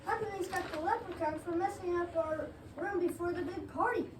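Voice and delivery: ordinary talk, nothing marked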